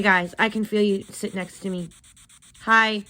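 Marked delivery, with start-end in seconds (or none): none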